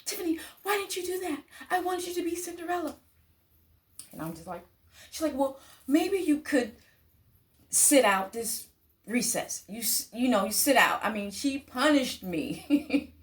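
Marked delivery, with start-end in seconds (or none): 0.0-3.0 high, fast scolding teacher voice; mock angry teacher. Teacher scolding Tiffany, high fast mock anger. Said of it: "this teacher was mad at me". 5.3-11.0 a hint of a stern teacher, pitched up; imitating the teacher handing out punishment; annoyed teacher. Teacher punishing Tiffany, mildly imitated stern voice. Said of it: "she was mad".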